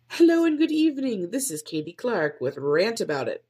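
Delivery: high formal evening-news greeting; formal announcer. Shown as a demonstration. Podcaster trying a formal intro, announcer voice. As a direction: open like a stiff TV anchor.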